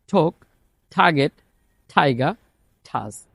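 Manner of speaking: each T released with a puff, like ঠ in টেলিফোন and টার্গেট; aspirated T words. Shown as a demonstration. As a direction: breathe out hard on every T, word by word. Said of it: "তাহলে যদি উদাহরণ বলি".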